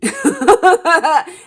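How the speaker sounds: high, loud laugh; a sudden loud laugh; burst of laughter. Real, not acted. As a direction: crack up at your own joke. Laughs at her Dirty Harry line.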